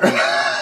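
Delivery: loud unvoiced laugh. Real, not acted. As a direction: burst out laughing. Laugh between quotes.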